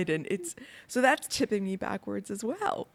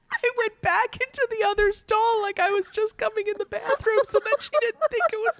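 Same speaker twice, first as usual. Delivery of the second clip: laughing through the story; 13 semitones higher, 5 dB louder than usual. Real, not acted. Laughing retelling embarrassment.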